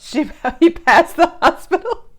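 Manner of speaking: high, loud question through laughter; laughing disbelief. Real, not acted. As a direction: burst out laughing as you ask. Laughing reaction to the film.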